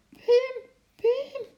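high-pitched startled cry; frightened scream. A narrator's reading. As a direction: call out in panic for help. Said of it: "Gillend kwam ze overeind". (angstig gillend)

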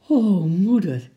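a slow, loud, overjoyed sigh of relief; beaming with joy. A narrator's reading. Girl thanking her mother after hearing the monkey may stay, beaming and loud. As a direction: let the happiness spill out. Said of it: "zei Lotje stralend".

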